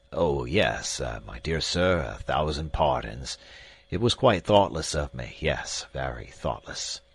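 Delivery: put-on an absent-minded old professor fumbling apologies; fussy old professor. A narrator's reading. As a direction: apologize in a vague, flustered way.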